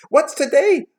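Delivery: high, loud call downward; crying out. A narrator's reading. Scrooge calling downward to the boy, crying out. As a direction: holler the question down from above. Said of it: "cried Scrooge, calling downward".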